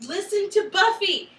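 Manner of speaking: high, loud mock plea; mock exasperated plea. Fan urging Buffy's team to heed her, mock exasperated plea. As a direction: plead loudly, in mock exasperation. Pleading on Buffy's behalf to characters.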